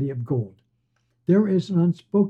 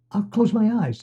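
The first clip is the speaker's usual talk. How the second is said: blurted quick and higher; the head blurts out its idea to the driver, higher; 6 semitones higher than usual. Acted. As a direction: blurt it before thinking. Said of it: "it blurted".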